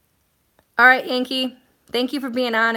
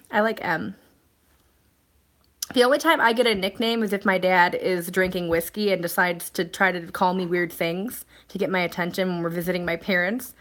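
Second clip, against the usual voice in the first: ordinary talk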